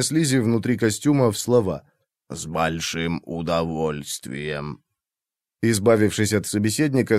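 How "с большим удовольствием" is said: deep, slow gurgle from inside a suit; gurgling tentacle alien. A narrator's reading. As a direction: bubble each word out, low and drawn. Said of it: "пробулькал Орбалин".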